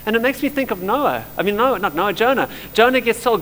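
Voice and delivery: usual speaking voice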